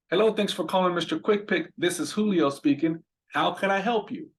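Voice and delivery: cheerful business phone greeting with a lift; upbeat phone greeting. Shown as a demonstration. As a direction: answer the phone like you're having a great day. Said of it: "I always say".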